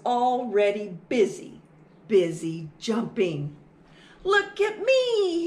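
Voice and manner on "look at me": put-on high, loud little-boy shout; excited shout. Little mouse showing off to Mom, excited shout. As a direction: call out proudly, high and loud. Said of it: "he shouted".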